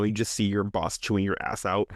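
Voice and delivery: ordinary talk, nothing marked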